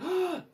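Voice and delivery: high startled gasp; scared gasp; sharp gasp. Retold or imitated. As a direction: suck in air in fright. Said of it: "as soon as I gasp".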